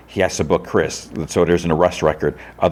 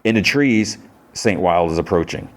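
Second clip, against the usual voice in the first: normal talking voice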